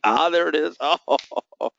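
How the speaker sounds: high, laughing relief; delighted. Real, not acted. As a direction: savour the moment with a laugh, drawn out. The drop he waited for arrives.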